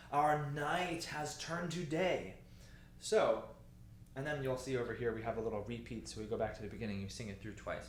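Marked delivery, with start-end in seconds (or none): 0.1-2.3 slow recitation, "night" and "day" drawn out; emphasis on night and day. Shown as a demonstration. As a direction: bring out the contrast of "night" and "day". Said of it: "let's really bring out that emphasis".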